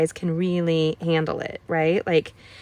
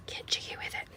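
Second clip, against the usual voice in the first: whisper; 15 dB quieter than usual. Real, not acted. Playful whispered crafting aside.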